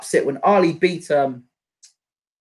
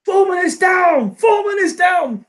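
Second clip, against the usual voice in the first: commentator shout; 11 semitones higher than usual. Retold or imitated. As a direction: belt it out like the big knockout call. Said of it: "Ali when he beat Foreman and the way".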